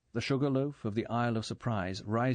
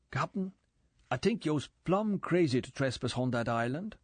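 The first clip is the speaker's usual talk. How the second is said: a lightly voiced Jamaican boatman, fretting; Quarrel's island accent, worried; 5 semitones higher than usual. A narrator's reading. Quarrel warns the Captain against the island, lightly voiced.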